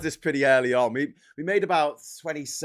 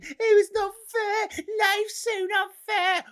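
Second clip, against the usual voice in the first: much higher, slow whining mockery; whiny gammon; slower than usual. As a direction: whinge like a sulky old man. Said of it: "they're always going".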